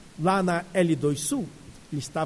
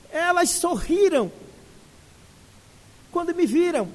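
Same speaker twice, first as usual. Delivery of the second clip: put-on raised, high-pitched old woman pleading gently; elderly lady voice; 5 semitones higher than usual. Old lady telling a crying girl about sick children, high and tender. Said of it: "Ela disse".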